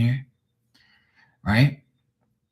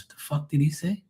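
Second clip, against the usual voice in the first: baffled reaction; 6 semitones higher, 5 dB quieter than usual. Retold or imitated.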